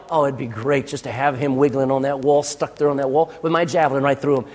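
low, level musing to himself; Saul musing. Acted. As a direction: mutter a grim daydream in a low monotone. Acting out Saul's murderous daydream.

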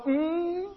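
high, drawn-out hum of agreement; grandma's approving hum. Retold or imitated. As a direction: hum like an old church grandma. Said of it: "she'd go".

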